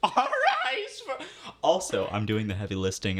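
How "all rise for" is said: high, slow booming announcement; herald voice. Pretend. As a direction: proclaim like a royal herald. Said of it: "lifting on the Herald voice".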